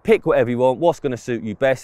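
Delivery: ordinary talk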